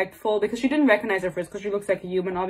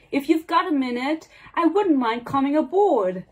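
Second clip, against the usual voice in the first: put-on higher-pitched semi-British accent; 5 semitones higher than usual. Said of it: "like, a semi-British accent there".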